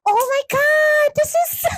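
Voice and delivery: very high, stretched-out squeal; mock amazed commenter. Everyone reacting to surgery video, mock squeal. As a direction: overdo the awe in falsetto.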